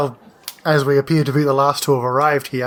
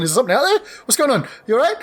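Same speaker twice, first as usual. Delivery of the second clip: higher, coddling pet-talk voice; talking to a cat; 9 semitones higher, much faster than usual. Retold or imitated. Player imitating Athora fussing over her cat, light pet-talk. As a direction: coo at the kitty, clueless. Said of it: "Athora runs up and looking at her cat going".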